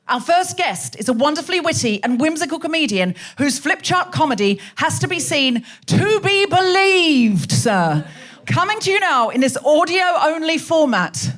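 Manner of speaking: rousing emcee introduction, stretching the final name; stage announcer. Acted. Host bringing the first guest on stage, rousing announcement.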